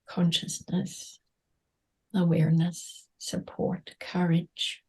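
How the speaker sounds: fading to a breathy whisper. Acted. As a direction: let the words dissolve into a whisper. Meditation words trail to whisper.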